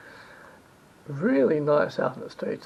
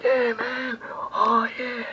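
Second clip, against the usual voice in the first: higher, drawn-out mock enthusiasm; excited greeting; 5 semitones higher, 4 dB louder, slower than usual. Staff welcoming the producer on camera, imitated gushing excitement. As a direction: imitate fawning excitement for a favourite guest. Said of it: "they were all excited".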